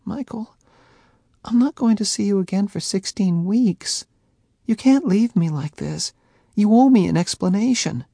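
high, rising loudness, upset; upset pleading. A narrator's reading. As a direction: make him answer. Faye demanding.

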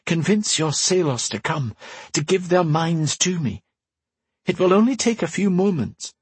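an urgent voice from afar, kept gentle; mysterious woman voice. Acted. Telepathic woman speaking.

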